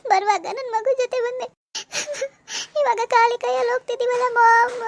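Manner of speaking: slow, tearful, sobbing through the words; crying. Acted. Daughter sobbing to her mom over the lost baby, slow and tearful. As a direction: let the tears break the line, slowly.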